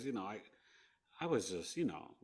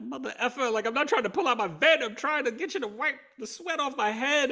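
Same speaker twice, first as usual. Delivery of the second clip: high, loud, exasperated yell; furious retold shout; 7 dB louder than usual. Patient shouting at the nurse's aide, high, loud and furious. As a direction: blow up at the aide who won't listen. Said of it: "I was so angry".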